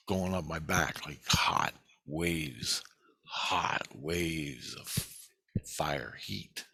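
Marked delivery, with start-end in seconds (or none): 1.1-6.7 slow, dwelling. Real, not acted. Describing burning feeling slowly.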